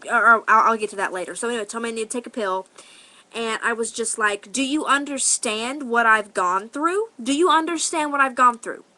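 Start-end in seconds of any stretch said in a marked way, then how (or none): 4.5-8.9 raised-pitch demand, building louder on the repeat; indignant. Retold or imitated. Teller re-enacts confronting the older woman who judged her, indignant. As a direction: re-enact an affronted challenge, climbing as it repeats.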